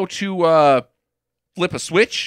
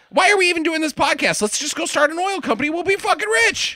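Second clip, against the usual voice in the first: high, rushed rant climbing higher; escalating comic outrage; 13 semitones higher, faster than usual. Real, not acted. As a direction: race upward into a squealed comic tirade. Comic rant escalating at absurd plot.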